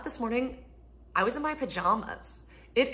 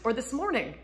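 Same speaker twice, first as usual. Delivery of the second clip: usual speaking voice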